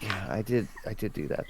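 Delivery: very soft, muttered aside; hushed admission. Acted. Client concedes to annoyed lawyer, very hushed. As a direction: own up to it under your breath. Repeats whispered confession to lawyer.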